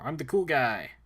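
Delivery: swaggering put-on voice; boastful tough guy. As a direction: brag like a big tough hero.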